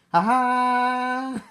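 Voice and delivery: long, high "gotcha" cry; triumphant reveal. Acted. Popping out to surprise the buyer.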